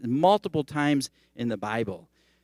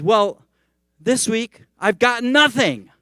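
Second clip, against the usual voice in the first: high, loud comic outrage; hammed-up aggrieved punchline, stretched at the end; indignant wail; 10 semitones higher, 8 dB louder, slower than usual. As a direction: wail it as the ultimate injustice. Punchline wail of the upset man.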